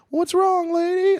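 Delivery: very high squeaky "What's wrong, lady?" voice; high mock concern. Comic imagining comforting crying lady, very high mock concern. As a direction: squeak it out way up high, awkwardly caring. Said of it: "I would've had to have been like".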